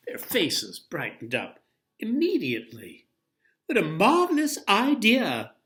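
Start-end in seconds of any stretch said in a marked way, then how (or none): 3.7-5.5 high, loud gleeful cry; delighted, almost laughing; chuckling delight. Acted. Dolly thrilled by the idea, high and gleeful. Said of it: "chuckled Dolly".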